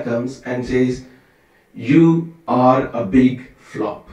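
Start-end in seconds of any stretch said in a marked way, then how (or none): 1.7-4.1 very slow, each word laid down; slow, pointed you are a big flop; slow blunt verdict. Retold or imitated. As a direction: deliver the insult deliberately. Brahmana insults Krishna's court.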